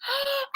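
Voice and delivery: a sudden high excited shriek; high gasping squeal. Retold or imitated. Meeting [name] face to face, high excited squeal. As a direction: let out a short, high fan squeal. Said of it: "And I was just like".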